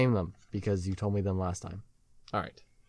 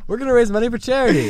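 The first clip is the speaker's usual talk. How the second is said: mocking; 12 semitones higher, 9 dB louder, much faster than usual. Mocking partner's pitch.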